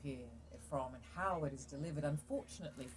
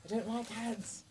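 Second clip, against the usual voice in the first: lightly imitating exasperated people, pitched up; mock complaint; 5 semitones higher than usual. Streaming viewers suddenly complaining about ads, mock exasperation. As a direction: grumble like a fed-up audience. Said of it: "people all of a sudden just started going".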